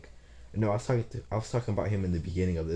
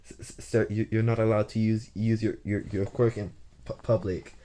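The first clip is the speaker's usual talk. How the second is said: acted stammer, tripping on first sounds; stuttering; 3 semitones higher than usual. Nurse telling boy he can't use his quirk, stuttering. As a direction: stutter as if barely able to talk. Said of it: "she's sitting there stuttering, barely even able to talk".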